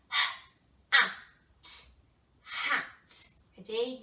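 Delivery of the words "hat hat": loud robot word. Shown as a demonstration. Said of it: "My turn first".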